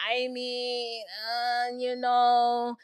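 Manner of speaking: drawn-out, reluctant hemming and hawing; hesitant driver. Retold or imitated. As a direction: stall softly, stretching each filler word. Uber driver hesitates over a fare.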